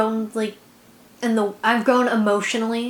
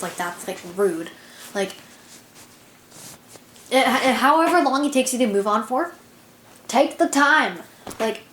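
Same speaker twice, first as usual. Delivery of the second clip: usual speaking voice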